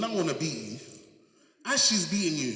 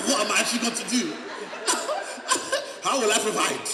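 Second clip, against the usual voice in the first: fast, high laughing panic; high giggling self-doubt; nervous laugh; 6 semitones higher than usual. Retold or imitated. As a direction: laugh through the worry. Laughing at own panicked thoughts.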